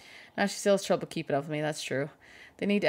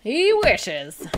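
mocking jab; 7 semitones higher, 10 dB louder than usual. Real, not acted. Teasing the husband.

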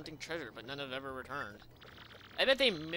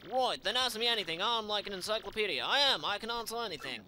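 gruff sailor; 6 semitones higher, 6 dB louder than usual. Acted. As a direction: challenge the stranger like a swaggering sailor. Rough sailor NPC voiced.

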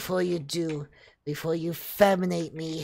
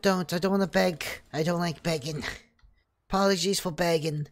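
usual speaking voice

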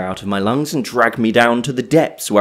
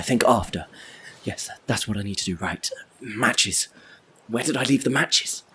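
ordinary talk, nothing marked

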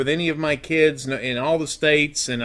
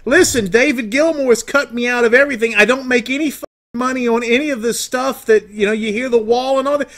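pitched up, heated complaint; worked-up rant; 8 semitones higher, 4 dB louder than usual. Acted. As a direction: get louder and more aggrieved. Caller rants about being cut out.

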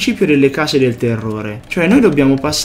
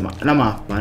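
usual speaking voice

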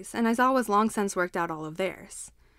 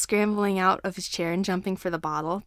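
ordinary delivery, nothing marked

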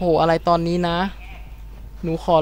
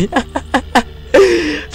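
high burst of laughter; 11 semitones higher, 3 dB louder than usual. Real, not acted. Enemy drops after one hit.

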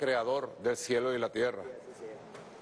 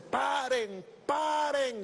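high, drawn-out mock yell; high-pitched shouted command; retold shout; 11 semitones higher, much slower than usual. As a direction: re-enact calling a crowd to halt. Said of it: "grité yo".

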